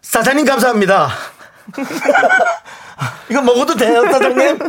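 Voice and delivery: high, laughing foreign worker voice; broken Korean, grateful and giggly; foreign worker accent. Pretend. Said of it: "드라마에 그분 걸로 해야죠".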